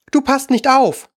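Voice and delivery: high-pitched, strict scolding; stern. A narrator's reading. Mouse scolding inattentive Alice, stern. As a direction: tell off an inattentive listener sternly. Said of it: "sagte die Maus streng zu Alice".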